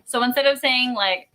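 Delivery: ordinary talk, nothing marked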